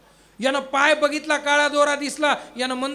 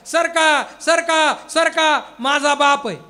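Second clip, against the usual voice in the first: higher, louder pushing through the crowd; shouting in the crowd; 4 semitones higher, 8 dB louder, much slower than usual. Retold or imitated. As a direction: yell at the crowd to move. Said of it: "हा जोरात म्हणू लागला".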